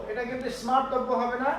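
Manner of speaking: ordinary talk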